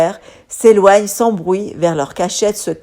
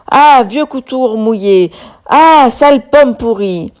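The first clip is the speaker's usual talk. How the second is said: raised, hammed-up name-calling; mock insults; 4 semitones higher, 4 dB louder than usual. A narrator's reading. Cutlery and peas trading insults, raised mock anger. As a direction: hurl the insults like a cartoon brawl. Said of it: "ce ne sont que cris et injures".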